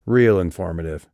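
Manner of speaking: ordinary delivery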